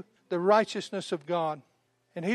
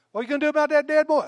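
higher, fast, pressing question; anxious husband; 6 semitones higher, 3 dB louder, much faster than usual. Retold or imitated. Husband presses his wife about the dead boy. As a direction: rush the worried question.

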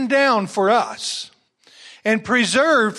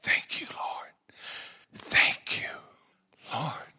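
soft whispered prayer of thanks; 9 dB quieter than usual. Shown as a demonstration. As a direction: breathe your thanks to God. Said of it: "the first impulse should be".

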